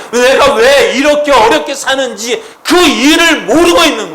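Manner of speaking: loud, high-pitched impassioned preaching; full-force preaching. Acted. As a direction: build it up and proclaim it with full force. (높고 크게 외치는 설교)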